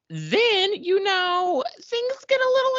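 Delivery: high, teasing, drawn-out tone; teasing sing-song. Acted. Telling her co-host, high and teasing. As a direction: tease the romance in a high put-on voice. Teasing aside about romance.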